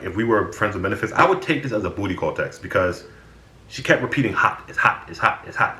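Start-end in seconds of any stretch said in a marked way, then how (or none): 4.3-5.8 whispered, teasing repetition of hot; mock whisper. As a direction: breathe hot over and over like a hint. Mocking Diana's repeated hot texts.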